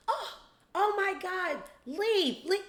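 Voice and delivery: high panicky parody of the prim couple. Retold or imitated. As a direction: clutch pearls and shoo them off. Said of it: "they're like".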